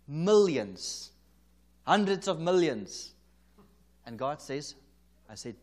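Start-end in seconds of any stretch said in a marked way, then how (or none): 0.1-3.1 excited proclamation. Retold or imitated. Excitedly announcing the prophecy.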